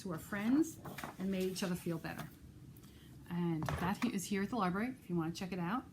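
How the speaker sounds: put-on bright read-aloud voice for kids; gentle storybook narration; storytime reading. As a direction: read it to a circle of children. Reads picture book to children.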